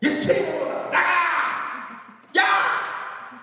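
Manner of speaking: preacher rebuking, fierce command; angry shout from the gut. Shown as a demonstration. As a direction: drive the wall out with a cry. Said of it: "단전에서부터 끌어오는 분노".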